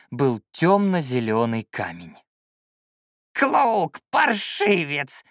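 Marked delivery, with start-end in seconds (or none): 3.3-5.2 put-on high, slow creaky old man's holler; creaky yell. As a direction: holler it like a cranky old shaman. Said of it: "на весь лес заорал он скрипучим голосом".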